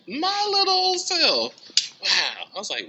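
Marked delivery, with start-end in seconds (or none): 0.1-1.5 very high, loud, slow squeal; giddy amazement. Retold or imitated. Reliving the win, high giddy self-amazement. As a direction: marvel at it in a tiny high voice. Retelling the winner email.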